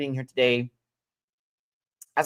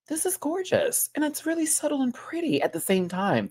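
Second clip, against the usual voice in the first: gushing; 14 semitones higher than usual. Retold or imitated. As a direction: gush over the look. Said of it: "I was like".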